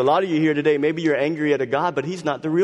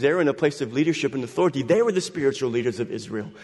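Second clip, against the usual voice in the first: ordinary delivery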